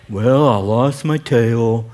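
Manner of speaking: slow, low, gloomy donkey voice; Eeyore voice. Retold or imitated. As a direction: drag it out like a mopey Eeyore. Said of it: "This donkey perpetually since nineteen twenty-three".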